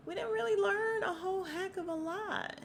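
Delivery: drawn high, singsong letdown; high-pitched reluctant verdict. Real, not acted. Vlogger admitting the documentary disappointed, high and singsong. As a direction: break it gently in a high voice.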